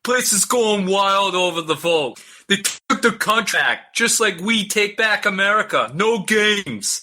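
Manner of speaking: put-on high, slow New York accent voice; a New York accent Trump read, drawn out; Trump impression. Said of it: "I'll do the accent".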